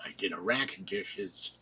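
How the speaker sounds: usual speaking voice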